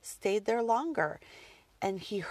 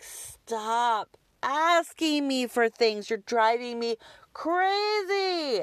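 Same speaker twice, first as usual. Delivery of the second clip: loud, high, drawn-out exasperation; exasperated yell; 4 semitones higher, 5 dB louder, much slower than usual. Retold or imitated. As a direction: yell it slowly, stretching the key words. Retells snapping at nagging son.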